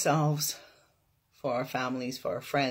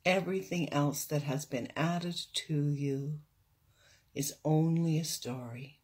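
guided meditation; 3 dB quieter than usual. Real, not acted. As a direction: guide them into stillness, unhurried.

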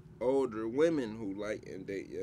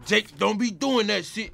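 loud, higher, hammed-up street tough from Fresh; movie character voice; 9 semitones higher, 10 dB louder than usual. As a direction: bark it like the guy in the movie. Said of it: "You know how they be talking in Fresh?".

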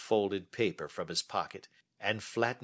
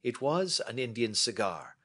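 a touch higher, measured and assured; lightly voiced Holmes; 8 semitones higher than usual. Holmes inviting Watson to sit and listen, lightly voiced. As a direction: hold forth like a confident detective.

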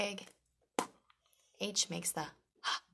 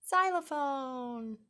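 excited reveal; 7 semitones higher, 4 dB louder, slower than usual. Acted. Slow, high reveal of the xylophone.

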